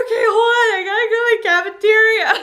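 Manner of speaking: high, fast, frantic squeal; crying. Retold or imitated. Overwhelmed daughter telling mom to wait, tearful squeal. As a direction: blubber it, acting out happy hysterics. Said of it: "I immediately start crying".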